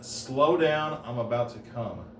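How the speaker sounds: ordinary delivery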